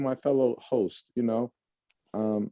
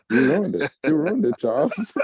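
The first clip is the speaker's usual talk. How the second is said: higher, louder mock outrage; playful complaint; 6 semitones higher, 4 dB louder than usual. Real, not acted. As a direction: whine that the moment's ruined. Friend interrupted the song.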